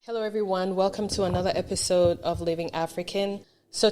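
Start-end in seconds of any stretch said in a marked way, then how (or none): none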